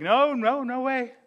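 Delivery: high, mock-frantic denial; panicked denial. Preacher voices Peter denying Jesus to the crowd, mildly panicked. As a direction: Peter flustered, brushing off the accusation. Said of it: "And he's like".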